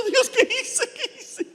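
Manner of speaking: high, breaking cry of regret; crying out to God. Retold or imitated. Father after spanking his daughter, crying out high. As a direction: wail it up high like a remorseful father. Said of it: "estaba yo llorando".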